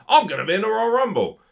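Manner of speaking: high, loud mocking wrestler impression. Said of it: "wrestlers going".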